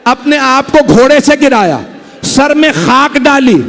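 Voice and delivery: ordinary talk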